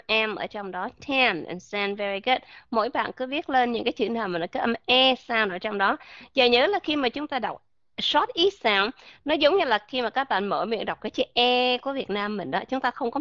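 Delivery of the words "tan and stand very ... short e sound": ordinary delivery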